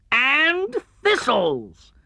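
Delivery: high, slow pained whine; high Tigger. Acted. Tigger adding thistles to his list, high and slow. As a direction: stretch it out, mouth still stinging.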